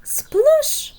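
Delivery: high, loud whispered splash; splash sound. Acted. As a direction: make a big watery sploosh. Frog falling into water.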